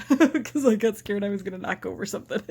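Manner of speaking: laughing through the words. Real, not acted. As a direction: giggle while explaining your own startled moment. Laughing about her startled slip.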